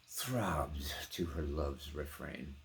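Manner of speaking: ordinary delivery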